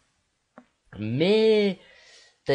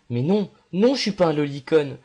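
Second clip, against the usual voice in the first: loud protest; 4 semitones higher, 6 dB louder than usual. Real, not acted. Protesting accusation.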